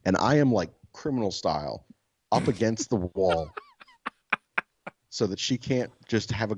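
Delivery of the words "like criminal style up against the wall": ordinary delivery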